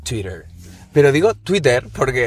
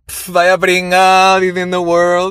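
slow, loud sneering "vaya pringao"; mock the kid who speaks English well, drawn out; louder, slow scornful mockery; 5 semitones higher, 5 dB louder, much slower than usual. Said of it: "La gente dirá".

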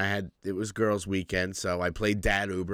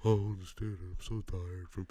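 softer, fading whine; mock whiny parent; 8 dB quieter than usual. Mimicking worn-out parents with a soft whiny fade. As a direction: sigh it like exhausted parents.

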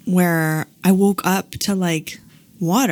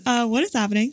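retold bewildered reaction; 4 semitones higher, faster than usual. Mother repeating her reaction for the listener, bewildered. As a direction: reenact mild confusion, rising at the end. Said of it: "That was my reaction".

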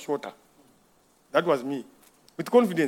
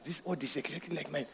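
much softer, hushed dealer's pitch; sly hushed offer; 5 semitones lower, 16 dB quieter than usual. Retold or imitated. Another mechanic tempting him to swap the part, hushed and sly. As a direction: lean in and tempt him quietly. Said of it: "another man is coming and suggesting".

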